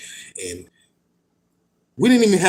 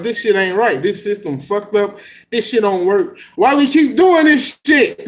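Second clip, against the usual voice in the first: rising to an exasperated higher shout; frustrated outcry; 7 semitones higher, 4 dB louder than usual. Retold or imitated. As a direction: vent at a broken system. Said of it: "I'm like".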